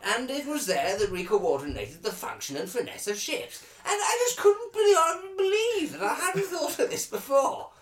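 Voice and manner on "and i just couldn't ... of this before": high, loud, near tears with joy; almost sob with amazement; overwhelmed. Acted. Overcome with his own idea.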